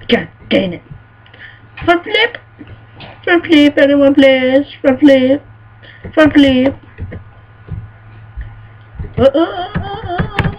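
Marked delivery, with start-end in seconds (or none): 1.8-6.8 high, loud begging; excited pleading. Real, not acted. Gamer begging the game for a front flip, pitched up. 9.2-10.5 high, rapid nervous grunts; panicked high noises. Real, not acted. Gamer panicking mid-jump with high squeaks.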